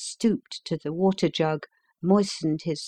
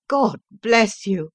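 passionate cry; 4 semitones higher, 4 dB louder, slower than usual. A narrator's reading. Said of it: "cried the prisoner passionately".

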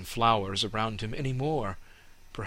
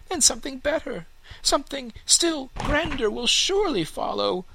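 high, dreamy hopeful tree voice; a young tree picturing its future; hopeful young fir tree; 4 dB louder than usual. Acted. Fir imagines grander things.

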